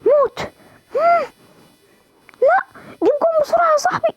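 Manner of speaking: very high, urgent plea; high-pitched desperate gamer urging; frantic urging. Real, not acted. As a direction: yelp at your teammate to hurry.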